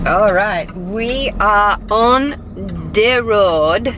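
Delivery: slow, drawn-out Jamaican lilt; Jamaican accent. Acted. Vlogger announcing the drive, in a Jamaican accent. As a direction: stretch it out with a hint of Jamaican accent. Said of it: "like I have a Jamaican accent".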